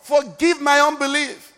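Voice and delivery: high-pitched, urgent exhortation; impassioned pulpit appeal; full-force preaching. Real, not acted. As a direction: urge the congregation to believe right now.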